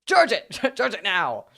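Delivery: high, loud mock-panicked order; frantic hammed-up command; urgent shouting. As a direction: yell it like a playful emergency. Urgent order about the dying phone.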